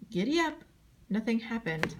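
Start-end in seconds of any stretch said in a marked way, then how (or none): 0.1-0.6 high, eager "Giddy up!"; yelled command. A narrator's reading. Boy on a horse for the first time yells high. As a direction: urge the horse on, pitched high. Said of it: "I yelled".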